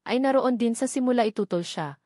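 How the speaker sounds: ordinary delivery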